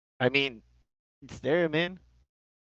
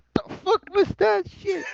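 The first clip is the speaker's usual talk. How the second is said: much higher, incredulous what-the-hell; shocked outburst. Retold or imitated. Teammates stunned by the voice on the mic, pitched-up shocked disbelief. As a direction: squeal it out in disbelief. Team reacts to surprising voice.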